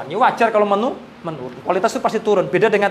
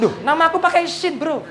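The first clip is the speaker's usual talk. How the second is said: a higher-pitched, mock-offended devil complaining; lightly voiced devil grumbling; annoyed devil; 9 semitones higher than usual. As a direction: play a devil sulking about his name. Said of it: "Setan marah loh".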